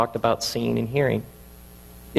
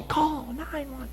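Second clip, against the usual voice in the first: high, slow, softer drawn-out call; slow motion; 13 semitones higher, 9 dB quieter, slower than usual. Pretend. Replaying his yell for help in slow motion, high and softer. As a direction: stretch the yell out as if time has slowed. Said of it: "in slow motion".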